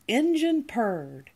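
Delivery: usual speaking voice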